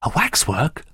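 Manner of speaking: hushed, high question; breathless guess in wonder; panting. A narrator's reading. Daphne guesses at the strange figure, panting. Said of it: "she panted".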